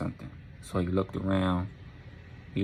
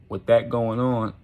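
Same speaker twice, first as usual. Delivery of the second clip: plain reading voice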